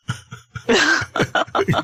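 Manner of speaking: a short high burst of laughter; real laugh. Real, not acted. Laughing at his own exaggerated mouth movement, a short high burst of laughter. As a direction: laugh at yourself, amused.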